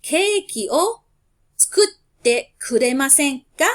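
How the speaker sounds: Keiki o tsukutte kuremasen ka said slowly and loud, syllable by syllable; teacher models the cake request slowly for the class; slow model phrase. Shown as a demonstration. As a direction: dictate it slowly for the class to echo. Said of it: "repeat after me".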